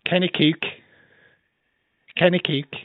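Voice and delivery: short, high Liverpudlian phrase; Scouse accent. Shown as a demonstration. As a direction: model a Scouse accent for a learner. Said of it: "Here's how you do it".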